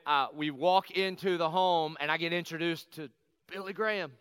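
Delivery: normal talking voice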